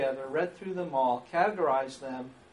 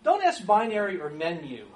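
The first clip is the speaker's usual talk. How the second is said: ordinary delivery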